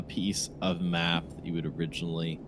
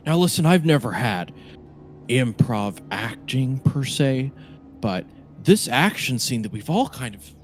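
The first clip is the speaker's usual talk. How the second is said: higher-pitched, animated film-maker pitching his scene; raised, emphatic showman's patter; Nicholas Prisons; 4 semitones higher, 5 dB louder than usual. Acted. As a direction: play an eager director sounding out the cast. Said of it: "Nicholas Prisons, uh, turns to you, uh, you all, and says".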